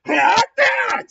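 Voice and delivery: very high, loud startled yell; acted panicked outburst; alarmed shriek. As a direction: shriek in disbelief. Acted alarmed enemy reaction.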